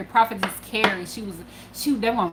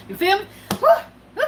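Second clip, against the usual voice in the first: much higher, whooping at the audience; hyped whoop; 12 semitones higher, 6 dB louder, much faster than usual. Real, not acted. Teller whooping to viewers about her eagerness, high and excited. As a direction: hype the crowd with a whoop.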